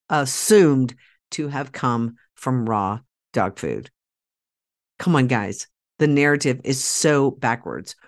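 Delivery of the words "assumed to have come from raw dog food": usual speaking voice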